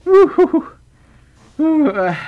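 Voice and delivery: long, loud, high "ooh-hoo-hoo"; high drawn-out hoot. Real, not acted. Streamer after thanking a donor, high drawn-out hooting. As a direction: hoot high and slow, then let it drop.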